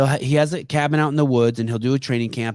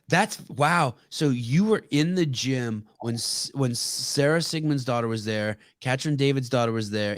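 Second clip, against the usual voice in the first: normal talking voice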